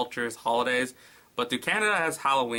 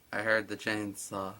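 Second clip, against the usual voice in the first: usual speaking voice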